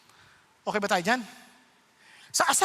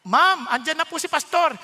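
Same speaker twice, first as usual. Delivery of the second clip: raised, higher announcing call; 4 semitones higher, 6 dB louder than usual. Retold or imitated. Housemate warning Ma'am that Pastor has come, calling out.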